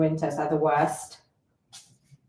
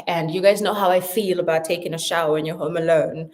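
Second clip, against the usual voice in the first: ordinary talk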